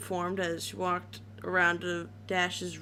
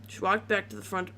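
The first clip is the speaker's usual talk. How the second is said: ordinary delivery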